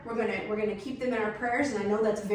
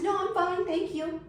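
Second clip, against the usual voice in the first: higher, tight-lipped polite no; polite refusal; 8 semitones higher than usual. Retold or imitated. Wife refusing to leave her husband, higher fake polite refusal. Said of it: "I said".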